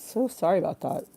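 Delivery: ordinary talk, nothing marked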